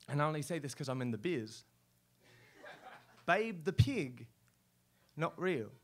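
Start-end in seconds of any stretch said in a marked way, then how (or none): none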